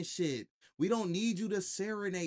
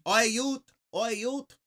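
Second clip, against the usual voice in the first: a loose imitation of a Jamaican or Canadian street accent, called out a bit louder; mock Jamaican accent; 7 semitones higher, 7 dB louder than usual. Streamer mimicking the guy's Jamaican or Canadian accent, in mock Jamaican accent. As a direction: toss off a playful mock Jamaican greeting. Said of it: "I hear the accent".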